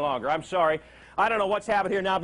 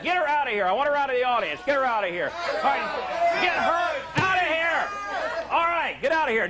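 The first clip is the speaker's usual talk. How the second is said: hammed-up higher ranting at a heckler; mock rage; 6 semitones higher than usual. Host ejecting heckler, mock rage.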